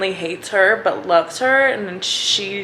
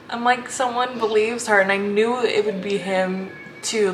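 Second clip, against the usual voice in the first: normal talking voice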